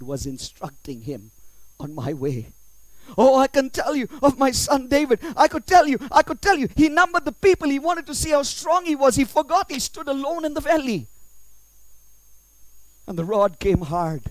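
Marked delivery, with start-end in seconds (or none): 3.2-11.1 loud, high sermon crescendo; full-force preaching. Acted. God lamenting his son David, loud impassioned preaching.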